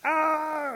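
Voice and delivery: high, loud cry of alarm; acted panicked shriek; scream. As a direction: scream as bees land on your head. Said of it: "And she's screaming too".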